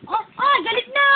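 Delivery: high, loud playful alarm; excited cooing. Real, not acted. Mother teasing her fussing baby, high and excited.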